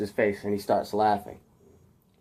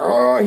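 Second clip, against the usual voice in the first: loud, raised straining yell; Tobey Maguire; 5 semitones higher, 8 dB louder than usual. Retold or imitated. Storyteller showing what Peter doesn't do, mock straining yell. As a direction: imitate a hero's effortful roar. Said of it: "he doesn't do the Tobey Maguire".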